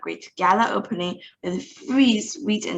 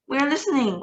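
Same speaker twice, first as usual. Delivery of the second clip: witches cry out, higher and eager; 7 semitones higher, faster than usual. A narrator's reading. Excited witches answer their leader, higher. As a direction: call it out excitedly. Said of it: "cried the audience".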